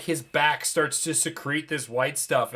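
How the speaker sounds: ordinary delivery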